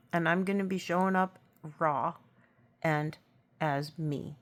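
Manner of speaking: ordinary talk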